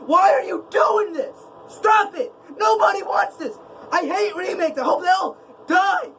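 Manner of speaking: high, heated shouting at Disney remakes; angry rant. Real, not acted. Vlogger ranting at Disney about remakes, shouting angrily. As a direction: rant at the top of your voice, fed up. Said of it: "I'm getting way too angry".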